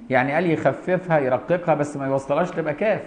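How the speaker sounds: normal talking voice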